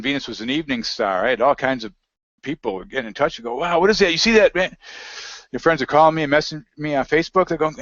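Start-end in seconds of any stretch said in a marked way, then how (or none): 3.6-4.8 high, amazed retold exclamation; excited caller. Friends calling about Venus, mock-amazed and high. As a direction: mimic a friend wowed by a light in the sky.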